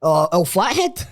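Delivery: put-on slow Australian accent; drawn-out Australian soap impression; Donald Fisher's Australian accent. Asked to do Donald Fisher, slow Australian accent. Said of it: "can you date Donald Fisher".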